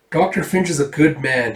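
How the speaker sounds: ordinary delivery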